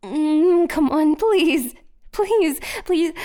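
high, loud, slow pleading; loud desperate begging. Acted. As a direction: beg with everything you have. Begging not to be searched.

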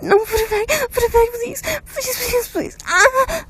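high, crying pleas; desperate tearful begging. Acted. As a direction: beg frantically through tears.

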